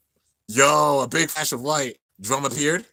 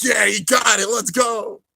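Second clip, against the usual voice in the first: cheering; 6 semitones higher, 5 dB louder, slower than usual. Retold or imitated. Teammates cheering the win.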